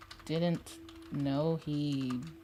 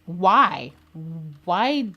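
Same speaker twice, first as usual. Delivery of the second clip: outraged shout; 8 dB louder than usual. Real, not acted. Outraged at loan transfer.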